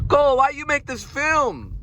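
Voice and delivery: high, odd put-on accent; hammed-up Wiseau voice; Tommy Wiseau impression. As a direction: boast about the masterpiece like Wiseau. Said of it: "It sounds like Tommy Wiseau".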